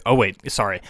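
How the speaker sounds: ordinary talk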